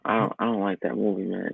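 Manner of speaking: ordinary delivery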